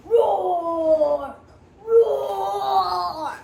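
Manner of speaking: loud roaring shout; dinosaur roar. Acted. Little Bill drawing a dinosaur, roaring loud. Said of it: "growled little Bill".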